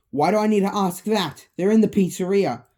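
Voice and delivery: fast, loud irritated retort; enraged. Acted. Mike snaps at Vanessa about the animatronics, angry. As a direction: throw the question back angrily. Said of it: "Mike is full of rage".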